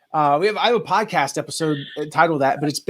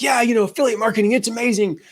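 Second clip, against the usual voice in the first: higher, hyped-up replay of his own sales pitch; retold enthusiastic pitch; 6 semitones higher than usual. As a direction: gush about it like you did on stage.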